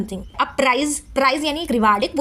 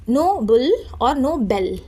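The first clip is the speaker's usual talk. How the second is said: slow contrast; much slower than usual. Shown as a demonstration. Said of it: "इनके बोलने".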